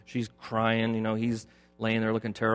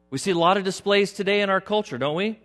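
raised, loud pulpit voice building; full-force preaching; 9 semitones higher, 6 dB louder than usual. Acted. Preacher rails at culture, loud full-force preaching. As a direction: drive it home to the congregation.

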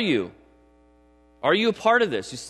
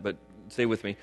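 normal talking voice

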